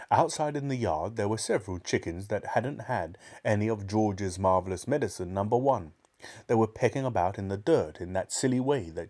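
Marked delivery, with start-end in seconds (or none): none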